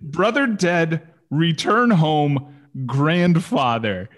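slow, deliberate telegram readout; cut and dry. Acted. Reading Lou's telegram about his brother, cut and dry. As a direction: read it word by word like a terse telegram. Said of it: "it was just so cut and dry".

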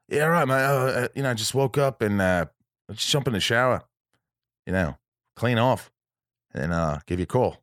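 imitated Aussie guest, stalling with ums; Australian accent. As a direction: do a put-on Australian accent, hesitating. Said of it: "always wants to do the Australian accent".

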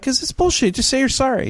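high, insistent retort; exasperated demand. Retold or imitated. Demanding an apology from the seatmate, exasperated.

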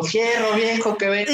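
high, stretched vendor's shout; street vendor loudspeaker call. Retold or imitated. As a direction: call it out like the junk buyer's recording.

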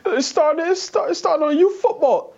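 high-pitched mock football star; Randy Moss. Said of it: "come in with his, uh, with his Snuggie on and say".

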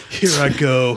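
put-on voice for a posing tree-chopper; Zoolander impression. Friend imagining him cutting a tree, mock Zoolander voice. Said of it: "going out like Zoolander".

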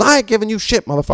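high mock-defiant snap; retold defiant comeback, pitched high; defiant retort. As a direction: throw it back at him with attitude. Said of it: "he goes".

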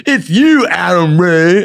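high, loud gotcha; gleeful reveal. Retold or imitated. Camp kid exposing the boy's labelled underwear, loudly gleeful. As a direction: catch him out with delight. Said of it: "they're like".

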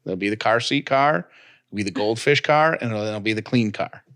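normal talking voice

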